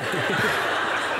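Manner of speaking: a quick airy laugh of disbelief; short breathy laugh. Real, not acted. Telling the host about the cartel boss, a breathy laugh. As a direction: breathe out a stunned little laugh.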